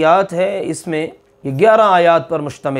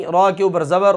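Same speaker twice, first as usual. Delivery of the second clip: ordinary talk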